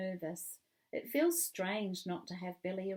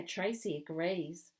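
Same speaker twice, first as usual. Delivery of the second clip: usual speaking voice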